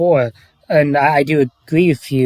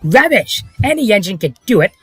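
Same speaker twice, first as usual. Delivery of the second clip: higher, scolding storybook engine; engine character voice; 5 semitones higher than usual. Acted. Reciting an engine's scolding line to friends, character voice. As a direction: lecture as a pompous engine, pitched up.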